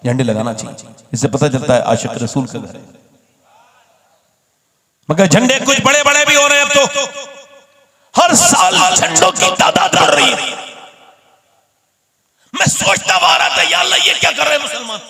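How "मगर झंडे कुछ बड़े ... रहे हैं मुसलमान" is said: high, loud shouted preaching; preaching at full force. Acted. Preacher to congregation about flags, shouting high and loud. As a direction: thunder at the congregation.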